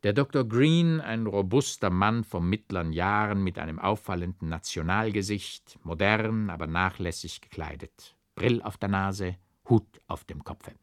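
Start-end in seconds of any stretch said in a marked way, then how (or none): none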